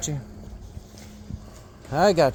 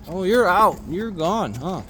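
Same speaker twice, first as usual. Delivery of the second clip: ordinary talk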